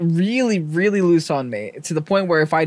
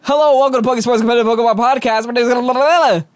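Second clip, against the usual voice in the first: loud eager host intro; rehearsing the show opener; practice intro; 5 semitones higher, 4 dB louder than usual. Shown as a demonstration. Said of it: "Can I practice?".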